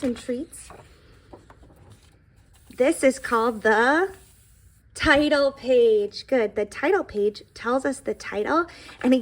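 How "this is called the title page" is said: put-on slow and deliberate for young listeners; slow, clear teacher voice; slow teaching. Shown as a demonstration. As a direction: draw each word out like pointing at the page. Teacher naming book parts.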